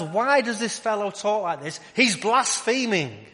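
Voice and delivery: high-pitched outraged objection; acted indignation, high and incredulous; indignant Pharisees. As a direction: voice the scandalised critic, pitched well up. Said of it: "thinking to themselves".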